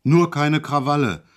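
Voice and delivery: sudden loud bellow. Acted. Orderly suddenly bellows at Hejula, loud. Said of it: "brüllte er auf einmal los".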